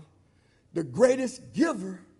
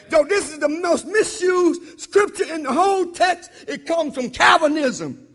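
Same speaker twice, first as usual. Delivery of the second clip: loud, high preacher's cry; fiery preaching; 6 semitones higher, 9 dB louder than usual. Acted. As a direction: preach it hard to the congregation. Preaching at full force.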